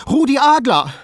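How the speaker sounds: high, loud, panicky cry; anxious insistence. A narrator's reading. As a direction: blurt it out in fright, pitch way up. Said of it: "um sich noch genauer auszuweisen". (ängstlich, mit Nachdruck)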